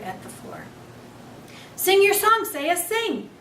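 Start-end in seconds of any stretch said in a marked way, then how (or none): 1.8-3.3 high, loud urgent squeak; a little hamster shouting encouragement; squeaked out loudly. Acted. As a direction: cheer her on at the top of a tiny voice. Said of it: "I squeaked out as loudly as I could".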